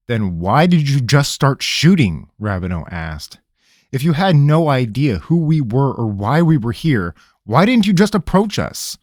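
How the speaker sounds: an irritated ranger; stern interrogator. Acted. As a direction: cut through his stalling. Rabineau voice.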